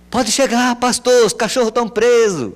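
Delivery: high, loud answering yell; shouted reply. Retold or imitated. Farmer shouting to the arriving pastor, loud. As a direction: shout back from the house. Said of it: "alguém gritava".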